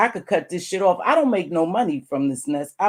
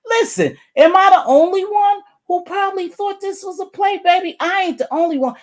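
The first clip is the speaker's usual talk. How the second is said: much higher, louder, insistent pushback; exasperated protest; 13 semitones higher, 4 dB louder than usual. Real, not acted. Vlogger defending herself to Ness, high exasperated protest. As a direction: rise up high and insist you weren't alone.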